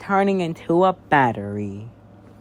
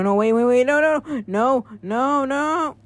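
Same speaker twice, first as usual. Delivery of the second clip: higher and louder frantic no's; panicked scream; 11 semitones higher, 8 dB louder, slower than usual. Acted. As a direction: scream as the batteries reach you. Batteries coming, panicked shouting.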